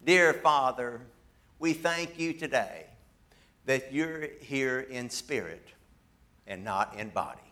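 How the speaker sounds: quiet, halting imitation of a prayer; little preacher praying. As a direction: pray softly in short, separated phrases. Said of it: "And the fella said this".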